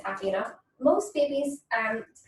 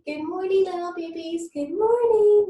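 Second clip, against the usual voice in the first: high, loud cooing greeting; 9 semitones higher, 7 dB louder than usual. Real, not acted. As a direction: coo good morning at the babies. Greeting babies.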